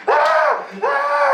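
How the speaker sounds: piercing high mock scream; kid scream. Kids screaming in the yard, high shrieks. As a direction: shriek like a kid running wild. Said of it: "go outside and go like".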